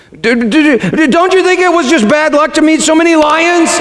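put-on high-pitched stammering boy; terrorized boy. A narrator's reading. Frightened Shasta questioning the lion, stammering high. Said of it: "said Shasta".

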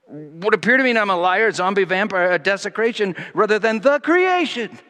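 loud, high, full-force preaching; a preacher building to a climax; fervent preaching. Acted. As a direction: preach it out with rising fervour. Impassioned preaching continues.

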